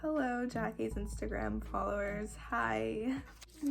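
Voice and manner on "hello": normal talking voice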